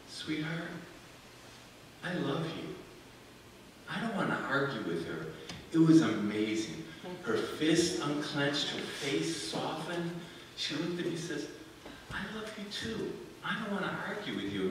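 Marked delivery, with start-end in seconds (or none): 0.1-5.3 quiet, tender reply to his angry wife; gently calm her down; husband speaking softly to his angry wife; soft answer. Retold or imitated. Defusing kitchen argument, soft answer. 12.1-14.7 soft reply. Retold or imitated. Wife softening after soft answer.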